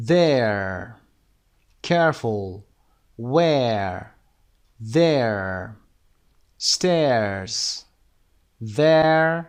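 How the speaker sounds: single words said slow and clear, each one stressed; model word list. Shown as a demonstration. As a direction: read each word out for repetition. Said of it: "اینا رو خوندیم".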